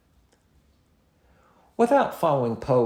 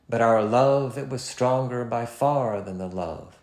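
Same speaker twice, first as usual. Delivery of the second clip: but our LOVE, slow, lilting anapestic beat; slow anapestic poem line; anapestic reading; slower than usual. Shown as a demonstration. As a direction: let the rhythm wait for the stress. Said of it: "Listen to the difference".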